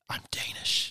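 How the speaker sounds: hushed, voiceless whisper of amazement; whispered revelation. Acted. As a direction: whisper it like a stunning discovery. Gasped mock revelation about Bradford.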